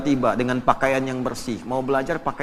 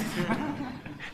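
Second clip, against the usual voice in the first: soft breathy laugh; 10 semitones higher, 11 dB quieter than usual. Real, not acted. Laughing at his own story.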